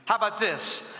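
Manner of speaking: ordinary talk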